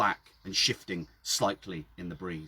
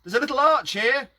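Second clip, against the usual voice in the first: calling up from below; 7 dB louder than usual. Acted. Mickey down the well shouting up to Phil, loud call. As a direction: yell it up from the bottom of the well. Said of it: "Mickey shouted up at me".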